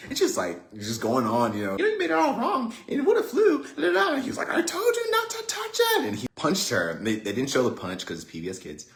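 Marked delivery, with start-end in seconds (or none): 1.8-4.1 put-on very high, sing-song nagging kid; little sister voice. Sister going on and on. 4.5-6.0 very high-pitched mock outburst; angry brother. Brother snaps before punching her.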